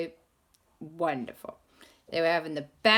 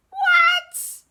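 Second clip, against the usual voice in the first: shrieking what; 12 dB louder than usual. Acted. Shocked reaction to sea creature.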